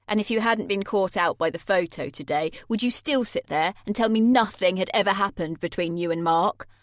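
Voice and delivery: loud, sneering challenge; bitter accusation. Acted. Jay accusing Sammy of lying about Mark, loud. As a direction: lay the accusation out coldly.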